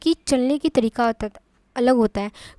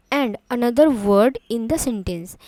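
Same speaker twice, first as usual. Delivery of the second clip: slow definition read; much slower than usual. Shown as a demonstration. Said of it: "teacher reads definition slowly".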